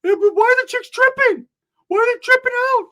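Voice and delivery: very high, loud whooping voice; Ricky hollering about the chicks. Retold or imitated. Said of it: "Ricky's over there whooping".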